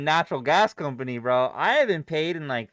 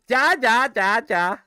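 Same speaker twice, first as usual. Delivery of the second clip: laughing mock; 7 semitones higher, 7 dB louder than usual. Real, not acted.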